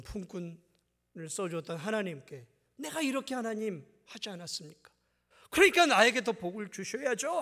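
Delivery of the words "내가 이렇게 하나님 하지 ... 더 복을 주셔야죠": demanding believer. Retold or imitated.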